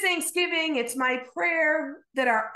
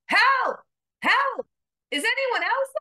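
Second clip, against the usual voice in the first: high, loud desperate calls; yelling for help; 11 semitones higher, 5 dB louder than usual. Acted. Jack refusing God's answer, shouting for someone else. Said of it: "Jack yelled".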